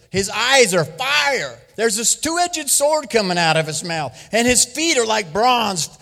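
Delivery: high, loud, slow preaching; preaching at full force. Acted. Preacher proclaims the vision of Christ to the church, loud and high. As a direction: proclaim the vision with rising fire.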